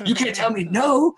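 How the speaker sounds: high, loud refusal to be told no; hammed-up stubborn outburst; defiant protest. Retold defiance toward wife.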